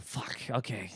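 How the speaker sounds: low, soft resigned aside; dismayed muttering. Retold or imitated. Comic summoned to see the star, dismayed muttering. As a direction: mutter it under your breath in dread.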